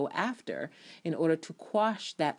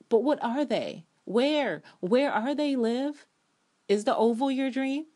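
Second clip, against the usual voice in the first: higher, louder demanding; urgent pleading questions; 8 semitones higher, 5 dB louder than usual. Real, not acted. Fan pleading with Liv to name her dreams, urgent. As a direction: press her for an answer.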